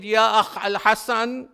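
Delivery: loud, high wailing call, slowed on the bad news; anguished scream. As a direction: cry out to his brother over their mother's body. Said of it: "he screamed and he said".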